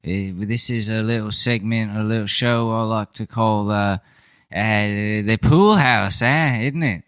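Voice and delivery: a comically bad British geezer; mock British bloke drawling out the pool house; bad British accent. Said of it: "a really bad British accent".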